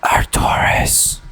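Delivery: slow breathy whisper of the name; creepy slow whisper in someone's ear. Shown as a demonstration. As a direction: whisper it slowly right into the ear. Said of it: "kinda like this".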